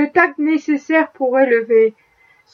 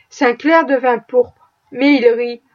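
ordinary delivery

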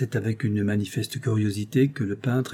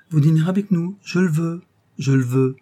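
higher, louder, commanding mistress; imperious insistence; 7 semitones higher, 6 dB louder than usual. A narrator's reading. Rose blocks the door to keep André for dinner, imperiously insistent. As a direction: insist like someone who will be obeyed.

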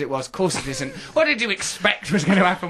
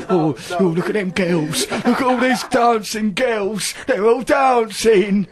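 mimicking leering G; slower than usual. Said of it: "That's what he's like".

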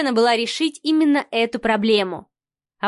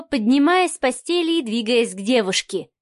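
ordinary delivery

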